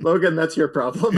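amused, gleeful passing of the buck. Retold or imitated. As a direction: hand it off with delight, voice lifted. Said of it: "gleefully kinda step".

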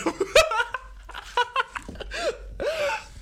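very high, shrill cackle of a laugh; squealing laugh. Real, not acted. Laughing at his own Chaplin joke, very high. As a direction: crack up uncontrollably.

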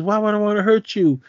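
high-pitched imitated plea; pleading. Accused boy appealing to Sonny, high mock plea.

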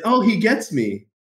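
a touch of being moved, voice lifted; touched, tearing up. Retold or imitated. Retelling the moment his mentor understood him, lightly touched. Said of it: "I'm tearing up thinking about that".

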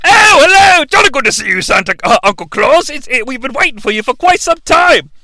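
high, loud cheery British welcome; hammed-up jolly good voice; jolly elf greeting. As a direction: greet him like a chipper elf. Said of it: "And they're like".

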